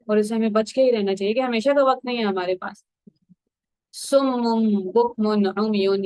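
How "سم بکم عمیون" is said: slow, measured recitation of the Arabic verse; slow Quran recitation. Shown as a demonstration. Teacher recites the verse to listeners, slow and measured. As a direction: draw the verse out slowly, word by word.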